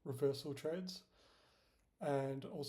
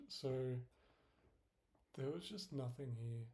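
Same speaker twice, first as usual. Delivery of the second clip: usual speaking voice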